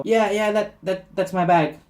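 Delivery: acted eager call across the counter; eager claiming. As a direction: stumble over the words to claim it. Spots bag, calls to clerk.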